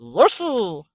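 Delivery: a short Yoshi creature cry; cartoon dinosaur call. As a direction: chirp the name like Yoshi. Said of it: "Do that again".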